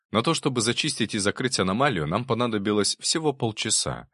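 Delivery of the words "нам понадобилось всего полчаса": plain reading voice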